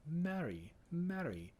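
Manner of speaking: "Marry" sung softly with a light touch of R. Shown as a demonstration. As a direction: say "marry" with only a hint of R. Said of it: "just a light touch of R".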